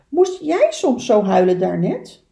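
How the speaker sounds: loud, earnest question; little beaver voice. Acted. Little beaver asking the duck who cried, loud questioning voice. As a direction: ask it loudly as a small animal.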